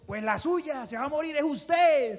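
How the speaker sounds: high, loud mock snap back from the cross; hammed-up comeback flung at the mockers; pretend angry retort. Jesus imagined snapping back at the Pharisees from the cross, mock angry. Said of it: "el Señor Jesús hubiera empezado en la cruz".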